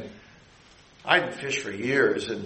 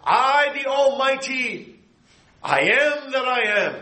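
very slow, high, near tears; overwhelmed divine proclamation; emphatic voice of God; 11 semitones higher, slower than usual. Acted. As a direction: stretch out "I myself" in awe. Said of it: "the I is emphatic".

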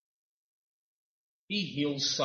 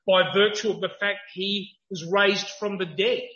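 higher, louder impassioned preaching; full-force preaching; 7 semitones higher, 8 dB louder than usual. Acted. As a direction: drive the point home to the crowd.

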